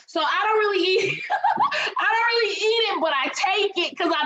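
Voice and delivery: laughing it out, pitch jumping up. Real, not acted. Laughing at her own story.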